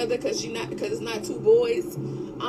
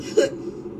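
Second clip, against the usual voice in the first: short laugh. Real, not acted. Laughs mid-rant.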